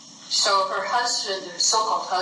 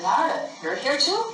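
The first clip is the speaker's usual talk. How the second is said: pitched up, startled to see a friend; surprised greeting; 7 semitones higher than usual. A narrator's reading. Krishna surprised to find Narada here, high and startled. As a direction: lift it in happy surprise.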